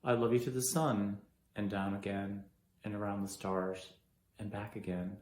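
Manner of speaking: low, soft, measured recollection; subdued grief. Acted. Left behind, recalling words of love, subdued grief. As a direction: remember it quietly, with pauses. Acted grieving memory of being left.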